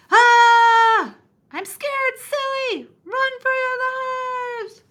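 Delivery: very high, loud panicked cry; scared scream. Acted. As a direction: shriek and flee in mock terror. Terrified onlookers scream at dragon.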